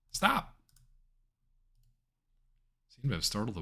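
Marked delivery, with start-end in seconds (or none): 0.1-0.6 sudden shout. Real, not acted. Shouting at fleeing stag.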